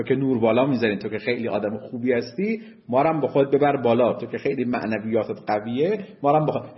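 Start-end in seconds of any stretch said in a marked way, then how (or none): none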